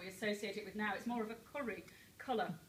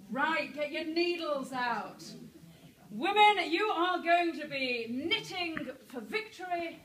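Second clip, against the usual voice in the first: rallying wartime recruiter; 3 semitones higher, 5 dB louder than usual. Acted.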